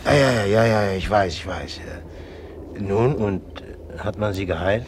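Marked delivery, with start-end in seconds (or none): 0.1-1.3 impatient. Acted. Impatient interruption of the patient. 1.3-2.8 muttered aside. Acted. Trailing dismissal after interrupting.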